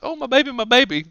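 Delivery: high-pitched imitated fretting; mock distraught mother. Mother fetching her baby from the thief, mock frantic. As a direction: cry out like a hammed-up worried mom. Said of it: "Of course, she's like".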